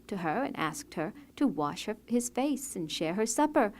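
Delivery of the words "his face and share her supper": usual speaking voice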